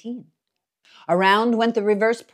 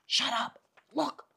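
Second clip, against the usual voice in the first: whisper; 4 semitones higher, 5 dB quieter than usual. A narrator's reading. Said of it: "whispered Jim".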